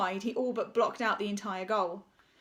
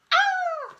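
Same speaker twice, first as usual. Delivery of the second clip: shrill high yelp of someone flung skyward; sudden high cry; 7 dB louder than usual. A narrator's reading. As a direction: yelp as you're launched. Referee flung into sky.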